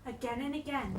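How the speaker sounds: ordinary delivery, nothing marked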